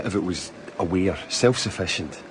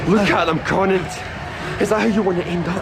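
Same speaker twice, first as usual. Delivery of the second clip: angry confrontation; 10 semitones higher than usual. Acted. Confronting Conant over the burned dogs, furious. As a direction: force him to look at the horror.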